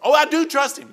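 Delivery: mock protest. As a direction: play the mother protesting too much.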